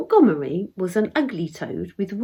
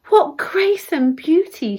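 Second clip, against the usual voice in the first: raised, louder fairy exclamation; crying out in admiration; 6 semitones higher, 4 dB louder than usual. A narrator's reading. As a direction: cry it out, high and admiring. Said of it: "she cried out".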